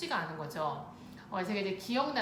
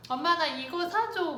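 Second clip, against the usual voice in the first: put-on higher, louder little-kid begging; lightly played child pestering a parent; pleading child; 8 semitones higher, 5 dB louder than usual. Four-year-old asking mom for candy in the market, pleading.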